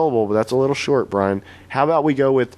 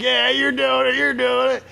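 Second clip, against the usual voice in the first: much higher mock Wilmore voice; Larry Wilmore; 14 semitones higher than usual. Host pressing Bernie to admit it, much higher mock voice. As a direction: squeal it like a teasing host. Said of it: "Larry Wilmore's like".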